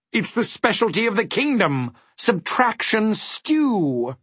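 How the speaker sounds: very high, proud character voice; pleased dodecahedron. A narrator's reading. Dodecahedron naming the kingdom's dish, pleased. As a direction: announce it high, stretching the final words. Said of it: "agreed the pleased dodecahedron".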